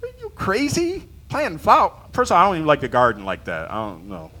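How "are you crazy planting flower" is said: much higher disbelieving cry; incredulous, hammed-up; mock disbelief. Preacher retelling his reaction to pastor's plan, high mock disbelief. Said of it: "The Lord said he wants us to go".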